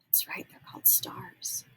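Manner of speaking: soft whispered praise. Real, not acted. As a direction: whisper the answer back gently. Confirming child's answer softly.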